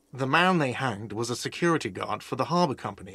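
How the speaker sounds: ordinary talk, nothing marked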